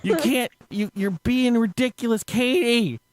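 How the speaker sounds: high, drawn-out imitated scolding yell; mock scream. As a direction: act out a man screaming at her, pitch up but not full volume. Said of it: "He proceeds to scream at her".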